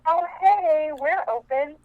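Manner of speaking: lightly voiced ghostly woman, pitched high; female ghost voice. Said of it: "a female voice go".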